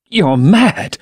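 high, rising frightened protest; Dorian, gun to his head, pitched high in panic; frightened cry. A narrator's reading. Cornered man cries out.

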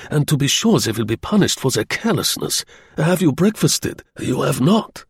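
put-on effusive apology, German accent; high, lilting German courtesy; German general apologising.

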